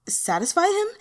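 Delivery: high, loud startled yelp. Acted. Yin Hanjiang yelps at Wen Zhen's plan, startled. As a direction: yelp it out in shock. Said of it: "Yin Hanjiang yelped".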